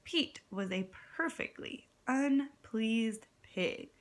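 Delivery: ordinary delivery, nothing marked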